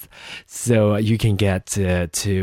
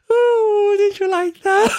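very high, laughing, teasing; squealy laughing tease; 3 dB louder than usual. Real, not acted. Teasing listeners after botched taxes, high laughing tease. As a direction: ask it through a laugh, teasing.

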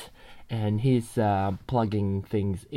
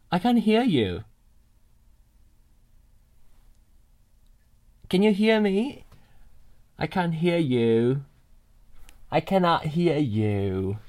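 louder and higher, calling over a bad line; on a video call that won't connect, raised voice; 6 semitones higher, 4 dB louder than usual. Real, not acted. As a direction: project it at someone who can't hear. Shouting to friend on call.

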